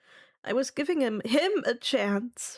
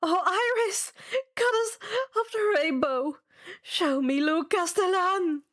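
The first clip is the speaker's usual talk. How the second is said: desperate plea; 8 semitones higher than usual. Acted. Fourth try, growing desperate.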